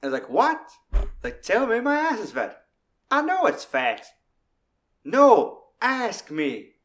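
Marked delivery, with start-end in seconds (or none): 0.3-0.6 high, loud mock bewildered what; baffled what. Sweet Dave baffled by Minnie, high loud mock surprise. Dave's baffled reply in retold scene. 1.4-6.7 high, loud put-on woman's voice; bossy Minnie. Minnie bossing Sweet Dave, high loud mock voice. Voicing Minnie's French joke.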